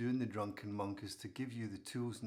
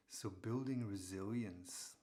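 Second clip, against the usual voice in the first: normal talking voice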